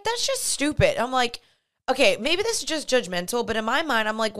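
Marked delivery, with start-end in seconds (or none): 0.0-1.0 much higher, scornful scoff. Retold or imitated. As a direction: dismiss it with disdain. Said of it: "which I'm like".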